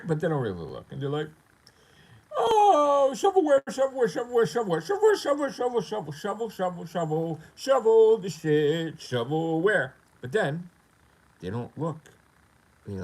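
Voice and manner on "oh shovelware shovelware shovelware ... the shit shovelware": high, loud chanting mockery; hammed-up repetitive jeer in a raised voice; mocking scoff. Critics dismissing his games as junk, mocking.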